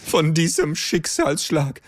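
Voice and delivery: milk the drama of a small loss; know-it-all creature wallowing in mock grief; mock tragic lament.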